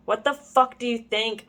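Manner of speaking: plain reading voice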